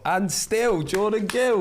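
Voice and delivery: booming ring announcer call. Acted. Announcer-style title retention call.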